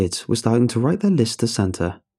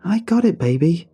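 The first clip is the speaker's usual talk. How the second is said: excited; 8 semitones higher than usual. A narrator's reading. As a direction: burst in with the good news. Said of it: "came in with a huge grin".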